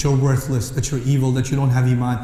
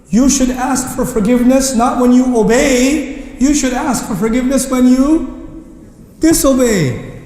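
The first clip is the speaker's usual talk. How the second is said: higher, slow, stressing "disobey"; emphatic; 6 semitones higher, slower than usual. Real, not acted. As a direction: lean hard on "disobey" slowly. Preacher stresses argument.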